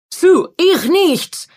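langsam, mit starkem russischem Akzent; russischer Akzent. A narrator's reading. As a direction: russischer Akzent, laut beteuernd. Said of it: "mit starkem russischem Akzent". (starker russischer Akzent)

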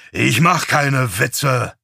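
drawn-out, emphatic retort; emphatic denial. A narrator's reading. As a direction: insist on it, word by word. Rebuts being accused of joking.

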